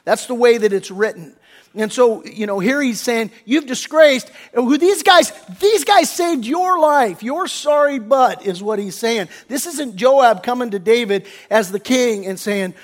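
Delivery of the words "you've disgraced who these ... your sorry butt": raised, higher-pitched indignant rebuke; acted angry yelling. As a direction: lay into him as if yelling. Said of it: "Joab is yelling at the top of his lungs here".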